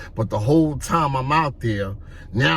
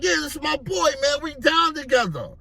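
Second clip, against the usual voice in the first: hyped vouching; 9 semitones higher, faster than usual. Retold or imitated. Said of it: "He was like".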